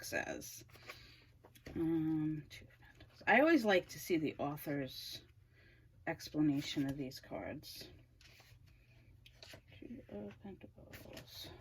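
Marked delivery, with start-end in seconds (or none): 2.5-3.2 whispering. Real, not acted. Reading the card name to herself. 9.8-11.5 barely voiced whisper under her breath. Real, not acted. Muttering while searching the book.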